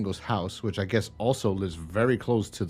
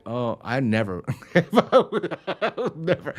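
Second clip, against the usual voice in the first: laughing refusal; 5 semitones higher, 3 dB louder than usual. Pretend. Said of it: "If anything, I'd be like".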